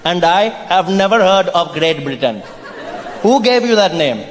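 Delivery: put-on Indian accent, questioning the newcomer; mock an arrival's boast as an Indian local; unimpressed Indian local. Plays an Indian facing the coloniser.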